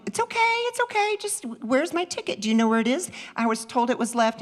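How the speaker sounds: high, sugary sweet-talking to calm him; high reassuring. Retold or imitated. Mom reassuring a nervous ticket boy, high and sweet. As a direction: soothe him in a high sing-song.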